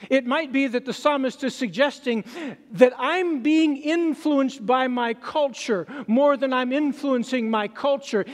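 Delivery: normal talking voice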